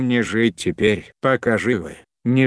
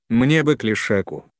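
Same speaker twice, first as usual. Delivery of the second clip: plain reading voice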